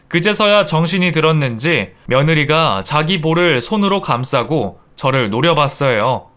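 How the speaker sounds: usual speaking voice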